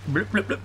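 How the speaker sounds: high playful bloops; drowning bubbles. Pretend. Player jokes that he's drowning, mock bubbling. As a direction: imitate bubbling underwater, high and light.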